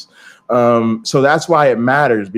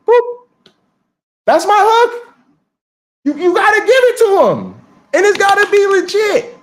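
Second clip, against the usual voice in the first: high, bouncy and triumphant; excited punchline. Real, not acted. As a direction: land the hook like a proud mic drop. Proud punchline about his hook.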